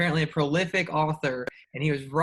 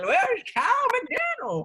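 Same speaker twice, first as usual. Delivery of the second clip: hype announcer; 4 dB louder than usual. Pretend. Hyping himself as opening act.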